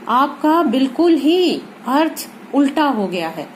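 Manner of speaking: ordinary delivery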